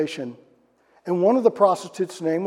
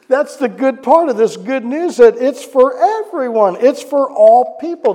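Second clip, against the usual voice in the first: high, loud preaching, slowed on everyone; preaching at full force; 7 semitones higher, 8 dB louder, slower than usual. Acted. Preacher proclaiming to the congregation, loud and high. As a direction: proclaim it to the whole room.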